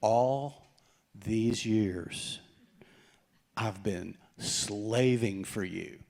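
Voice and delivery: slow, heavy "All ... these years" with long pauses; dramatic pauses. Retold or imitated. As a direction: drag out "slaving" slowly, pausing between words. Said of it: "dramatic pause".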